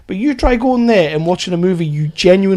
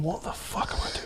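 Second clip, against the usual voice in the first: hushed, whispered self-questioning; imagined actor's aside; 16 dB quieter than usual. Retold or imitated. Co-host imagines Cranston regretting the role mid-scene, hushed aside. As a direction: say it under your breath, barely voiced. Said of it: "just looking at the floor going".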